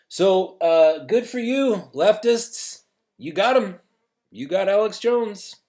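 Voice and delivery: high, loud mocking praise; sarcastic congratulation. Host mocking the leftists who banned Alex Jones, sarcastic high praise. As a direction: congratulate them with biting sarcasm.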